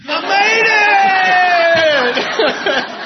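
shouts it high and loud from far off; thrilled high loud hail across the airport; joyful shout. Retold or imitated. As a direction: yell out the arrival in triumph. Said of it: "and he's like".

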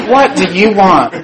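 high, loud defensive demand; mock hostility. Newcomer suspecting the stranger wants money, defensive demand. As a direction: challenge a stranger, played for laughs. Wary reply to approaching stranger.

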